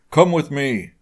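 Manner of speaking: a touch of a growling father; growled, mildly angry. A narrator's reading. Father orders his daughter away, mild growl. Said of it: "Mister Withybottom growled at his daughter".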